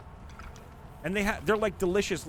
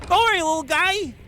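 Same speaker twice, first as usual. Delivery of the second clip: very high, loud dismissive yell; mocking shout; 13 semitones higher, 8 dB louder than usual. Streamer yelling at a kid to go away, mocking. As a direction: shoo him off with a yell.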